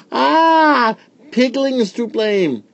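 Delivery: very high, loud bawl; Peony bawling in pain and blaming Pigling. A narrator's reading. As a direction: wail it out like a hurt spoilt child. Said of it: "Peony bawled".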